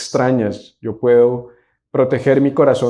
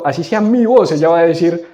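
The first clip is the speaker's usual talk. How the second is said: ordinary talk, nothing marked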